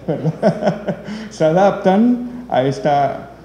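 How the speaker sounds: talking through a real laugh. Real, not acted. Presenter joking about foreigners enduring the heat, laughing. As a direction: let the laugh stretch the words.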